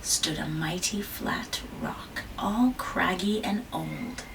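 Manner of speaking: hushed storyteller whisper. Acted. Mother reading whispers the setting to her child. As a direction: breathe it like a secret. Story narration, rock described.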